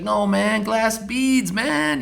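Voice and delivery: high, loud mocking voice of the German guy; German engine guy. Engine guy scolding the young restorer, high and mocking. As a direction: lecture him in a mocking high voice. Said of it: "he's like".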